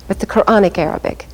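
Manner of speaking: ordinary delivery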